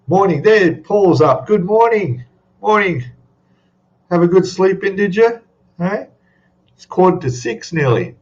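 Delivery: high, sing-out good morning; teasing morning greeting. Real, not acted. Host ribs a viewer who just got up, high teasing greeting. Greets late riser joining the livestream.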